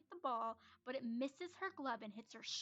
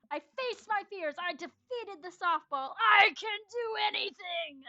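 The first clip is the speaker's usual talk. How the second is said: triumphant; 7 semitones higher, 12 dB louder than usual. Acted. Amanda triumphant.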